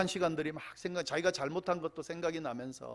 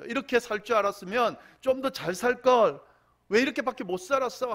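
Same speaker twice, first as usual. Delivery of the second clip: louder and higher, fast bitter outburst; a dying man's mock angry regret; angry self-reproach; 8 semitones higher, 6 dB louder than usual. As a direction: lash out at your own wasted life. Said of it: "하면서 막 분노하게 된다".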